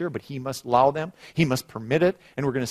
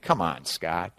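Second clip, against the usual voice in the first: low-pitched mock chiding; imitated skeptic; 9 semitones lower, slower than usual. Listener doubting the preacher's fear, chiding him in low voice. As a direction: drop low, as a doubter would.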